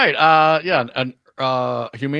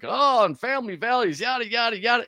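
mocking; 11 semitones higher than usual. Mocking Republicans' talking points.

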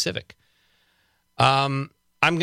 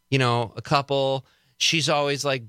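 ordinary talk, nothing marked

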